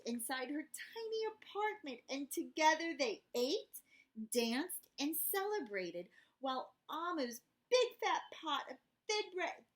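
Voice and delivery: put-on gentle read-aloud for kids; storybook narration. A narrator's reading. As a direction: tell it like a bedtime story. Reading picture book to children.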